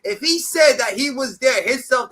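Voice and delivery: rising anger at the claim; angry outburst. Real, not acted. Heated rant about the case.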